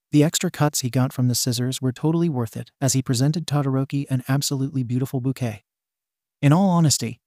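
plain reading voice

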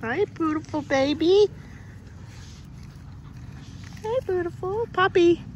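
high-pitched puppy greeting. Real, not acted. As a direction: coo sweetly at a puppy. Cooing at a puppy.

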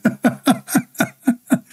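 high-pitched giggle; cracking up at the story; high laugh. Real, not acted. Laughs at the married wife story.

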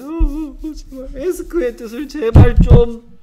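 loud, high-pitched mock pleading; hammed-up begging. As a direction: beg desperately, exaggerated and very loud. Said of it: "제발 막 빌면서 얘기했어요". (과장되게 애원하며 비는 목소리)

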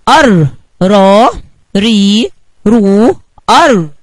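loud, separated "أر، را، ري، رو": arr, raa, rii, ruu; short-vowel drill. Shown as a demonstration. Recap of the friends' vowel signs, each syllable held. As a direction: punch out and hold each r syllable. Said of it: "الحركات القصيرة لحرف الراء".

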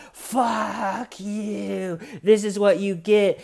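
high, stretched-out mock yell; imitated taunt from a video. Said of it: "you can hear from the video".

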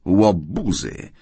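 slow, drawn-out snarl; gruff, disgusted growl. A narrator's reading. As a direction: snarl it like an insult. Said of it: "warknęła panna Howard".

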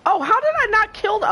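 high, incredulous yelp; frustrated surprise, pitch leaping up; baffled outburst. Real, not acted. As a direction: exclaim in disbelief at the miss. Shot fails to kill target.

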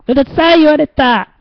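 high, wounded cry; mock dismay. Real, not acted. Host reacts to a viewer calling him noisy, high mock dismay. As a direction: whine that you got told off.

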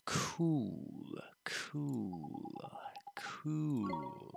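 breathy whispered "Cool". Real, not acted. Gamer reacting to the menu, whispered. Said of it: "whispered reaction".

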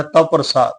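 "ਤਵ" said with a light half vav, "ਤਵ ਪ੍ਰਸਾਦ" almost "ਤ੍ਵ ਪ੍ਰਸਾਦ"; half vav pronunciation. Shown as a demonstration. Teacher showing the sangat the half vav, lightly. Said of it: "ਅੱਧੇ ਵਹੁਏ ਦੀ ਤਰ੍ਹਾਂ ਉਚਾਰਨਾ ਹੈ".

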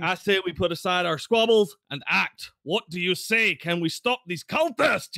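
paladin rallying a crowd, rising; rousing speech. Acted. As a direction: fire them up, ending in a shout. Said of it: "trying to give them a Braveheart speech".

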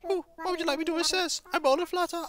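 a hint of a polite, fussy old owl; owl curator voice. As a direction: play the courteous museum owl, lightly.

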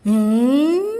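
ordinary talk

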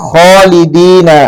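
খালিদিনা slowly, with a heavy খ; খালিদিনা, খ read heavy, not as খা; heavy kha. Shown as a demonstration. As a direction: say খালিদিনা with a thick খ. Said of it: "খা উচ্চারণ করা যাবে না".

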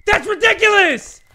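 loud, high outraged cry; shouted frustration; exasperated shout. Real, not acted. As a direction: blow up at the game. Said soft then shouted.

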